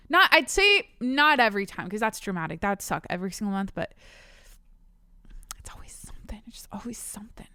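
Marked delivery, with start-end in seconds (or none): 5.6-7.5 breathy confiding whisper. Real, not acted. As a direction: let it slip out under your breath. Aside about monthly troubles.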